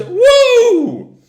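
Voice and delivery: very high, loud whoop of joy; cheering whoop. Retold or imitated. Tangle cheering as the log flies off the wave, high loud whoop. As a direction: let out a wild cheer. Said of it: "Tangle cheers".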